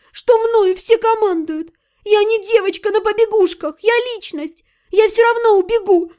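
put-on high, stubborn girl's voice; defiant younger sister. A narrator's reading. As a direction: dig in like a sulky teen.

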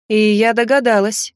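ordinary delivery

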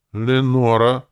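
high, loud character line, lightly creaky. A narrator's reading. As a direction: call out the name with a hint of creak. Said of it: "проскрипел он".

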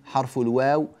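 usual speaking voice

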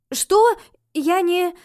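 very high, loud disbelief; startled protest. A narrator's reading. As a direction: blurt it out, shocked. Shocked protest, cut off.

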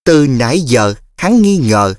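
plain reading voice

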